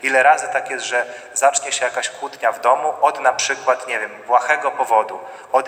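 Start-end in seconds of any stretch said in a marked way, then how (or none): none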